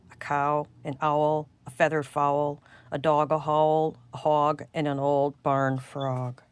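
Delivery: ordinary delivery, nothing marked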